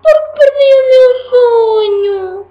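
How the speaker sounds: high, loud, slow sobbing; sobbing teddy bear. Acted. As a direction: wail it like a heartbroken toy. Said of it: "continuou a chorar".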